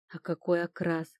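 ordinary delivery

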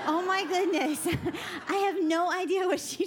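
normal talking voice

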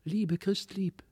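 high, soft lisping doll voices; tiny dolls whispering sweetly, pitched high; fine little doll voices. A narrator's reading. Dolls pleading sweetly with Christlieb, high and soft. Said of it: "lispelten mit feinen Stimmchen".

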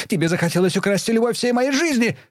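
very high raging cry; shrieking accusation. A narrator's reading. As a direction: shriek it at the mother. Daughter screams accusation.

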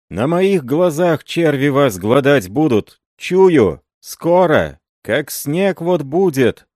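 high, loud cursing, slowing at the end; furious curse. A narrator's reading. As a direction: rage and prophesy doom. Marina curses Andrey in rage.

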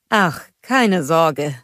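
slow, lightly voiced reassurance; Christoph's voice. A narrator's reading. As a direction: brush off her worry easily.

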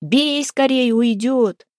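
high, drawn-out urging call; urgent shout. A narrator's reading. Харапун urges Демка to strike the runaway, urgent shout. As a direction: cry out as if the prey escapes. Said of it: "кричал где-то невидимый Харапун".

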